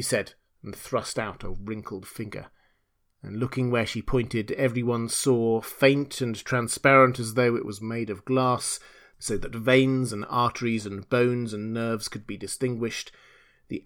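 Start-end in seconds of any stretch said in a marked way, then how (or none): none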